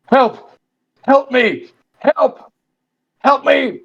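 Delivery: high, desperate cries for help; calling for help. Acted. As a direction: call out high and urgent from the dream. Dream voice crying for help.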